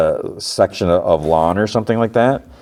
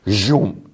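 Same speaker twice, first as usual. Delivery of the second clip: drawn-out voiced zoom sound; lightsaber whoosh; 3 semitones higher than usual. Jod takes out his lightsaber, whooshing sound effect. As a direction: imitate a lightsaber swiping.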